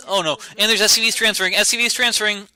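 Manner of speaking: loud, high, rushed call; urgent play-by-play as trouble breaks out; alarmed excitement. Real, not acted. Caster seeing workers transfer into danger, alarmed and excited.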